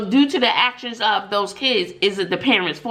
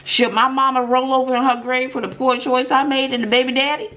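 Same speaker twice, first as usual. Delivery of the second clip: usual speaking voice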